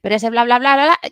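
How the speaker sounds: fast breathless bla bla; rushed babble. Shown as a demonstration. Voice coach demonstrating fast talkers, hurried babble. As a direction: rattle off words without breathing. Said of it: "quieren decir muchas cosas en poco tiempo y entonces aceleran".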